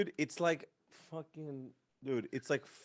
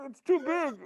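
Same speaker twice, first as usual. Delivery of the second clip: high, polite fake voice; mock polite; 13 semitones higher than usual. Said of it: "So I'm like".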